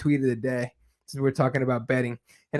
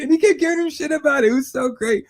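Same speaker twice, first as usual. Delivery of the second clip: still laughing; 11 semitones higher than usual. Real, not acted. Still laughing after the story.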